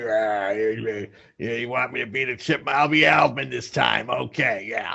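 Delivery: lightly voiced old cigar smoker; a hint of a gruff old man; old guy with a stogie. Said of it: "sounds like some old guy, like, smoking a stogie".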